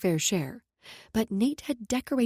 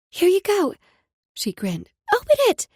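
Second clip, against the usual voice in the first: put-on excited little girl; 10 semitones higher, 8 dB louder than usual. Child voice, Brooklyn.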